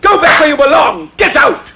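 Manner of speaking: high-pitched angry tirade; furious yelling. Acted. Master throws the slave out of the house in a rage. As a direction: berate the slave at the top of your voice.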